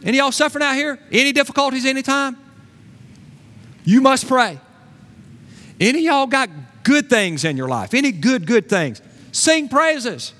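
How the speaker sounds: high, loud preacher's call; impassioned exhorting voice, high and punchy; James calling out. Acted. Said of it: "James would say".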